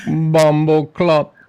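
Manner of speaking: slow, loud mock Jamaican curse; Jamaican accent. Friend answering the host in a loud mock Jamaican accent. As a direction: drag out the Jamaican swear. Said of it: "then I went Jamaican".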